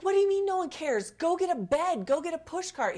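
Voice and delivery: high, loud, urgent demands; frantic yelling. Retold or imitated. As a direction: bark orders to get help now. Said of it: "And I'm like".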